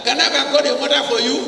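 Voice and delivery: high, fast mock blurt; a pretend sister spilling the secret; hammed-up eager girl, high and fast. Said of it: "Did she say".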